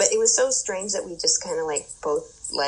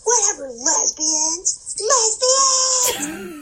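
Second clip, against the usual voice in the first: much higher and louder mock scream, slow, rising to a shriek; hammed-up shriek at the passing women; retold screech, slow and climbing; imitating a screaming jogger; 14 semitones higher, 6 dB louder, much slower than usual. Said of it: "She started screaming more".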